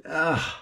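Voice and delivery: high pained ugh; high groan of dismay. Real, not acted. Seeing how close it was.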